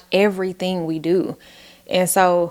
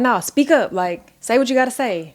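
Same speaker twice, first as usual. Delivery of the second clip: parent correcting; 5 semitones higher than usual. Retold or imitated. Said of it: "And you're like".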